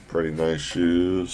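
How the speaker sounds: usual speaking voice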